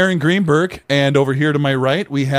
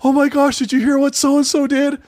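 gossiping mock voice. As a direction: gush like a gossip on the phone. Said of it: "we're like".

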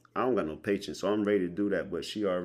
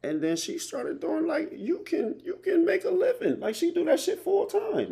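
high, loud and eager about the side hustle; excited; 13 semitones higher, 7 dB louder than usual. Real, not acted. Bragging to a friend about a home girl's earnings, excited and high. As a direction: pitch it way up, thrilled for her.

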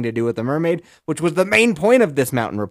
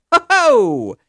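mock cheer; 9 dB louder than usual. Sarcastic cheer after library quip.

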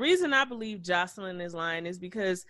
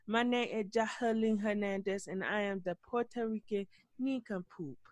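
mock Puerto Rican accent, softer than usual; Jocelyn Hernandez; 7 dB quieter than usual. Host mimicking a reality star's introduction, mock Puerto Rican accent. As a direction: put on a hushed Puerto Rican accent, mocking. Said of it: "I can't do the accent like you".

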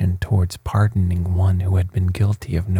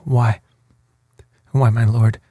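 hesitant, slightly higher, nervous start; trembling; 3 semitones higher than usual. A narrator's reading. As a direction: falter on the first word, mildly scared.

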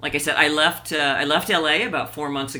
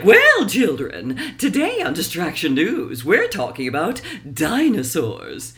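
Dora Smarmy voice; 2 semitones higher, 4 dB louder than usual. Acted. Dora Smarmy opening Distraction News for children, loud and smug. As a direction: announce it like a smug kids' news anchor. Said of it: "Dora Smarmy is a version of him".

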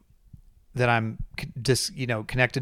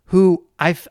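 normal talking voice

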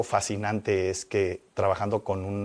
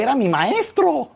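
moved declaration; 8 dB louder, slower than usual. Retold or imitated. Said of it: "Le dije".

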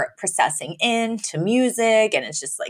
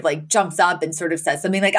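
ordinary talk

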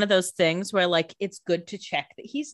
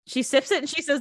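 usual speaking voice

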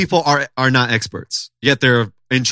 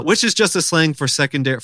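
ordinary talk

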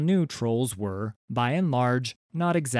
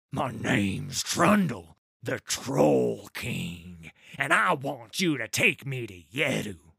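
slow, weighty self-announcement; slow proud troll king. Acted. Trundle to Sligu, slow and proud. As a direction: let each word of the title land.

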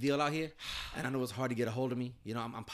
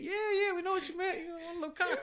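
high, sing-song mockery; mocking friends. Co-host mimics friends teasing Mike, high and mocking. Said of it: "He's like".

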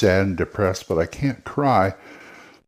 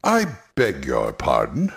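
lower, a touch haughty; lightly voiced snob; a hint of a snooty villain; 7 semitones lower than usual. Shown as a demonstration. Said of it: "if they're kinda snooty".

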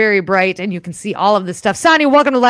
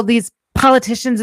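usual speaking voice

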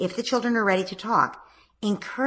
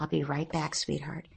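soft, hushed reassurance; beneath my breath; 3 semitones lower, 8 dB quieter than usual. Shown as a demonstration. Mom quietly reassuring her son before leaving the bed. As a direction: murmur it close, just for him. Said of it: "almost beneath my breath".

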